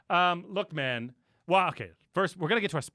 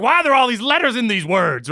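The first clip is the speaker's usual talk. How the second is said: louder, higher mock complaint; mock outrage; 6 semitones higher, 7 dB louder than usual. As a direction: complain like an indignant Franklin. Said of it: "he's like".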